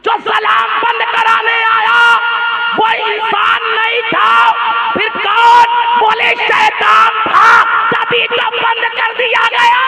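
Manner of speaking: fiery preaching. Acted. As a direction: declare it slowly and forcefully. Preaching at full force. (ऊँची, धीमी, जोशीली तक़रीर)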